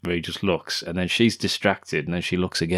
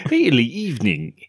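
higher, hammed-up English accent; mock English accent; 5 semitones higher than usual. Co-host echoes the film line, mock English accent. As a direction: send up a very English voice. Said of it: "with such an English accent".

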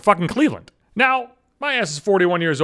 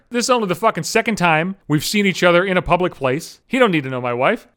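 ordinary talk, nothing marked

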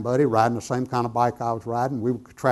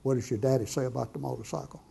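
soft, plain voice of the Holy Spirit; hushed, matter-of-fact question; plain-spoken; 9 dB quieter than usual. Retold or imitated. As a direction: quote it quietly and simply. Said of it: "just as plain as I'm speaking to you".

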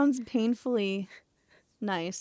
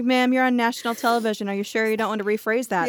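mock interviewer; 3 semitones higher, 7 dB louder, faster than usual. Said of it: "It's like".

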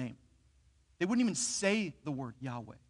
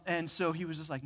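ordinary talk

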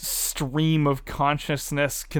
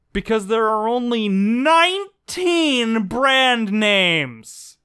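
mock dramatic reveal; 7 semitones higher, 6 dB louder, slower than usual.

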